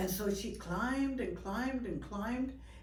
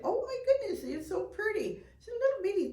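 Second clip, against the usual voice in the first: cooing at animal; 13 semitones higher, faster than usual. Real, not acted. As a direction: coo at a cute little critter. Cooing at baby raccoon.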